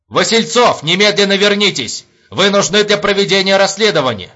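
loud, high urgent order; shouting. A narrator's reading. Major calls his runaway commander by phone, shouting. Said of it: "крикнул в трубку майор".

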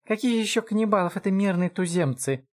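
high-pitched exasperated elf; outraged. Acted. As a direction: scold your would-be rescuer. Эльф ругает спасителя. (возмущённо, высоким голосом)

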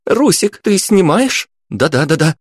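put-on high, excited teen girl; eager girl hurrying her friend; giddy party girl. A narrator's reading. Teens filming excitedly.

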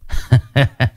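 put-on a scheming old man's short laugh; sly chuckle. A narrator's reading. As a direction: chuckle to yourself, plotting. (tiếng cười khẩy của lão chủ)

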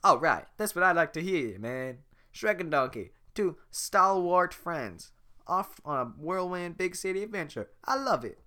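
high, loud and excited; excited Donkey. Acted. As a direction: bounce with eager delight.

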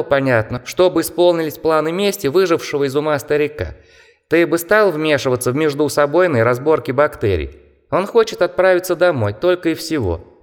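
high squeaky mocking bat. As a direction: chatter like a scornful little bat. Bat character explains.

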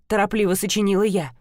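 plain reading voice

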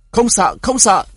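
a high, loud yell of reassurance. A narrator's reading. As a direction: shout to steady the fleeing people. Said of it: "cứ hét to". (hét to, giọng cao và mạnh)

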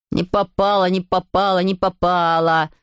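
sing-song brotherly teasing, slowing at the end; taunting. A narrator's reading. Anselm taunts Adele after she missed, teasingly.

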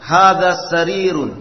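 loud, clear, deliberate Arabic vocabulary reading; model reading drill. Shown as a demonstration. Teacher modelling Arabic words for students, loud and clear. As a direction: read each phrase out crisply for the class to copy. Teacher leads class repetition.

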